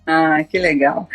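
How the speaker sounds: ordinary talk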